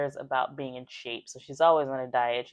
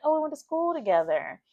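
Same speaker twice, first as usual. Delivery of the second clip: high, louder breezy answer; pitched-up casual guy reply; chirpy guy voice; 13 semitones higher, 5 dB louder than usual. Retold or imitated. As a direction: toss it off brightly, pitched up. Jack answering Stella, voiced.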